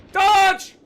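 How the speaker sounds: urgent high shout; shouted order. Acted. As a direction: yell a warning mid-fight. Calling out orders during the game.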